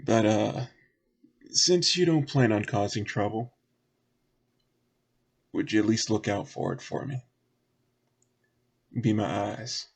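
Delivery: folksy request in a light Southern voice; lightly voiced local. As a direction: enlist their help, lightly in character. Game master voices Southern character.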